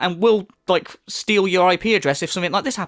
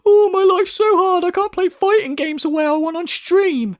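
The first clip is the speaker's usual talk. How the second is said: high, slow mocking whine, much louder; mocking whiny crybaby; 11 semitones higher, 4 dB louder than usual. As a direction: whine it like a self-pitying crybaby. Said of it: "you're crying over the fact".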